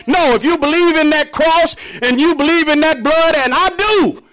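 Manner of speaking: fervent preaching. Real, not acted. Preacher refuting false doctrine to his church, fervent and high.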